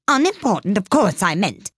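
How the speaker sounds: high-starting, blustering correction; hasty correction. A narrator's reading. King corrected by the Rabbit, hasty bluster. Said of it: "the king hastily said".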